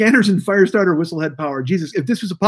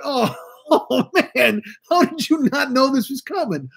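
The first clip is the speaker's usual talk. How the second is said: laughing; 7 semitones higher, slower than usual. Real, not acted. Cringing at the radio.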